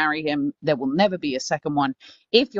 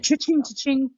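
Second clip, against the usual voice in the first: cha-ching; 9 semitones higher than usual. Pretend. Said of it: "echoing the till sound".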